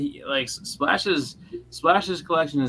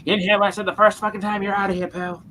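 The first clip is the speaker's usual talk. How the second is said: higher imitated snap at the caller; mock angry snap, raised pitch; snapping; 7 semitones higher than usual. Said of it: "he snapped on him".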